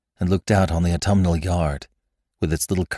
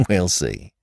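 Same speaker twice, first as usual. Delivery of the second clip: knowing laugh. A narrator's reading. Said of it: "laughed the other woman".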